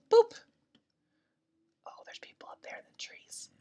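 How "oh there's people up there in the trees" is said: hushed unvoiced whisper; whisper, watching the others. Real, not acted. Gamer whispering while spotting players in the trees. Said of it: "I don't know why I'm whispering".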